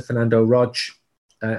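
normal talking voice

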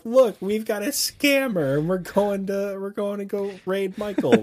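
mocking announcement. Streamer calling out a scammer to chat, high and mocking.

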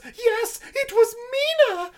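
very high, strained cry of the name; shrill hysterical woman. Retold or imitated. Retelling the asylum woman naming Mina, very high shrill cry. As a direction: shriek the name out in terror. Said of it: "the woman who's like".

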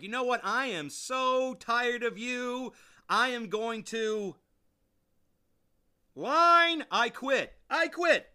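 loud, high exasperated outburst, stretched out; fed up, build slowly to a high, loud yell; losing it. Retold or imitated. John Candy tells Dan Aykroyd he is quitting, losing it. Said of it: "John Candy loses it".

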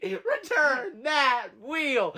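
slow, drawn-out mock repeat; mock game show echo. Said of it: "I was gonna repeat".

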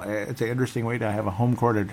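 usual speaking voice

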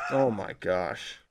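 spent. Real, not acted. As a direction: sigh it out after laughing. Winding down after laughs.

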